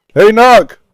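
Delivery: loud high hey to the dog; loud hey to the pup; loud call. Real, not acted. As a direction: grab the dog's attention. Calling to dog.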